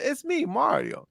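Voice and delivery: put-on high, slow Mario catchphrase in a light accent; Italian accent. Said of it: "He just said".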